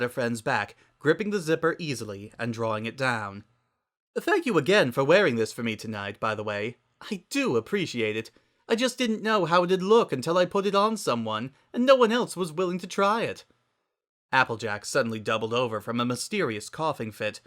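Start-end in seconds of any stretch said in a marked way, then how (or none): 4.2-13.5 high, theatrical and refined, louder; Rarity voice. A narrator's reading. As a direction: gush it out grandly. Rarity character voice.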